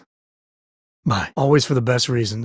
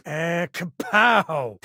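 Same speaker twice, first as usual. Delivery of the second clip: slow, drawn-out high kapow; a playful cartoon punch noise; mock kapow; 6 semitones higher, much slower than usual. Teasing a friend with a comic kapow.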